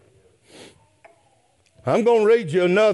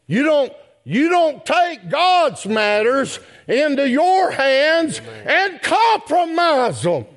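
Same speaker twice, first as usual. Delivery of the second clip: higher, rising, slowing preacher's cry; preaching at full force; 7 semitones higher than usual. Acted. Preacher to brethren, warning against compromise in a rising cry. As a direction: drive the warning home as it climbs.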